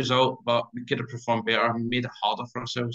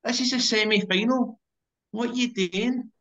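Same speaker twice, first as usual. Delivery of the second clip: much higher, disbelieving cry at a teammate; high-pitched exasperated what-are-you-doing; exasperated outburst; 12 semitones higher than usual. Retold or imitated. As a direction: retell your own incredulous yell from the stands. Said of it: "I was like".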